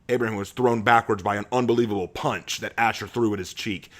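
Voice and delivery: ordinary delivery, nothing marked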